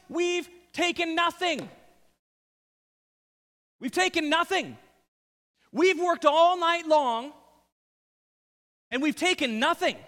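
loud, high-pitched exasperated complaint; emphatic exclamation. Acted. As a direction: hammer home the protest, high and loud. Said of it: "read it with emphasis".

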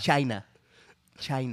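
low, soft presidential mimicry; Donald Trump. Friend warming up the impression, low as Donald Trump. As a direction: mimic the politician's one-word catchphrase. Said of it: "as Donald Trump".

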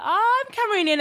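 excited. Retold or imitated.

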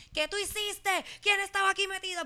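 high, sharp accusing mother; high-pitched mock outrage at a daughter caught lying; mom's scolding. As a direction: imitate a mother catching her daughter in a lie. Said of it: "Y mi mamá me dice".

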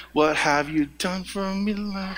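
a cat demanding its due, coolly; haughty cat. Pretend. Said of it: "The cat's like".

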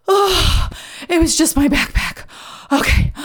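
high gasp then relieved line; gasp, then sag with relief; relieved discovery. Pretend.

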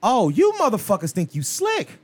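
ordinary delivery

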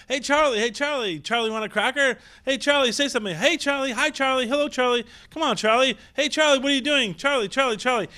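high, sing-out calls to a pet bird; crowd coaxing bird. Acted. Onlookers calling to a caged crow, coaxing. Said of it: "everyone's standing around going".

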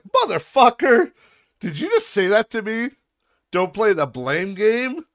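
incredulous, offended comeback; angry retort. Retold or imitated. Telling off the boss who dodged blame, indignant. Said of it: "And I'm like".

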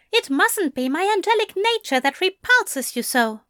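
high-pitched, haughty sniff; miffed angel voice, high and prim; sniffy. A narrator's reading. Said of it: "Aziraphale sniffed".

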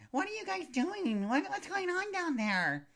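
high, slow drawn-out call; exaggerated high-pitched curious crowd voice; gawking onlookers. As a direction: imitate nosy onlookers, stretched out and high. Voices gawking parents on the stairs.

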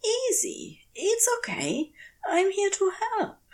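high, soft coaxing to a frightened creature; gently calm a scared animal; soft and soothing. Acted. Man soothing a trapped creature, soft and coaxing. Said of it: "He keeps his voice as soft as he can".

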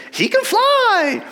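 very high, stretched falsetto cry; Peter Pan-like character. Acted. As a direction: marvel aloud in a slow squeaky cartoon voice. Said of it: "a Peter Pan-like character says".

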